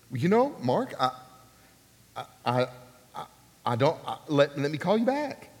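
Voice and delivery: imitating a man lost for words; halting false starts with long gaps; hesitant stammering. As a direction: stall and stumble through a reply. Frank hesitates at the odd request.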